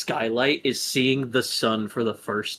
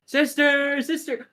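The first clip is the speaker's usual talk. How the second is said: much higher, drawn-out calling of sister; sing-song calling; 14 semitones higher than usual. Acted. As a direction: call her like a teasing sibling. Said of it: "And goes".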